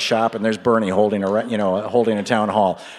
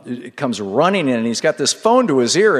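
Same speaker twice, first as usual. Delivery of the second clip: ordinary talk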